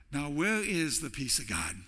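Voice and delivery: a hint of Deb asking kindly; wife's gentle challenge. Pastor voicing his wife correcting him, lightly imitated. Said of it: "She'll say something like this".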